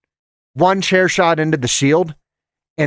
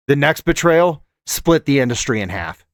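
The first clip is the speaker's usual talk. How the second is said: ordinary talk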